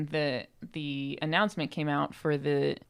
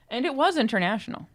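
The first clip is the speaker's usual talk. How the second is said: triumphant; 6 semitones higher, 5 dB louder than usual. Real, not acted. Triumphant confirmation.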